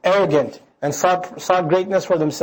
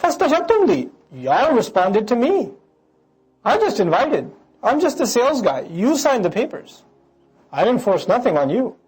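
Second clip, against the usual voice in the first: higher, smug Shaitan shrugging off blame; Shaitan speaking; 4 semitones higher, faster than usual. Acted. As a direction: play the devil as a slick salesman washing his hands. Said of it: "their leader's leader now speaks".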